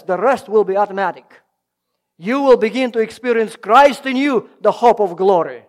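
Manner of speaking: loud, driving sermon delivery; preaching at full force. Acted. Preacher urging the congregation to act on God's Word, loud and driving. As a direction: press the point home to the congregation.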